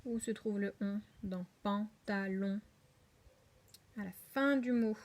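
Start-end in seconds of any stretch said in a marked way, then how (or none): 1.6-2.6 slow "pantalon" as pan, ta, lon; syllable by syllable. Shown as a demonstration. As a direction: break "pantalon" into three even beats. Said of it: "Voilà, trois syllabes".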